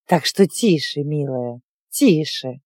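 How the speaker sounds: rock her to sleep with words; soothing a child. Acted. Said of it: "Голос был грубым, но ритм её слов успокаивал".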